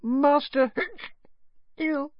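very high squeak broken by a hiccup; drunken little elf close to tears; tipsy, tearful elf. A narrator's reading. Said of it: "Her bottom lip began to tremble".

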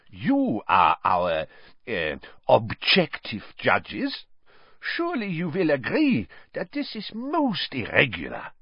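butter up the judges; unctuous. A narrator's reading. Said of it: "his voice unctuous once more".

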